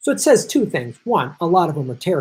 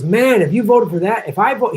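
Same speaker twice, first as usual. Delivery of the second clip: lightly voiced mobster, a touch higher and louder; mob style threat; 6 semitones higher, 4 dB louder than usual. Mobster warning the union guy about his vote, mock menace. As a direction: deliver the veiled threat like a wiseguy. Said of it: "this is the way he put it".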